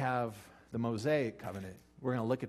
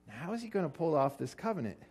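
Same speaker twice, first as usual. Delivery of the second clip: ordinary delivery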